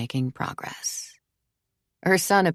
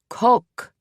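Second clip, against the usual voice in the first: high, loud French pronunciation; crisp French vowel, pitched up; French accent; 8 semitones higher, 5 dB louder than usual. A narrator's reading. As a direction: say coq as a French child would. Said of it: "precisely the same accent and inflection the boy".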